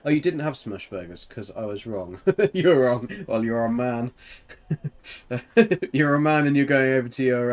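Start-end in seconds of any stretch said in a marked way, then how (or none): 2.4-7.5 loud, higher teasing through laughter; laughing mockery. Real, not acted. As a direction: tease them, breaking into laughter. Teasing partner, laughing between phrases.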